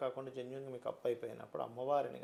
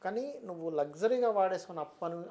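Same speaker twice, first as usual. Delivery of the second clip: ordinary delivery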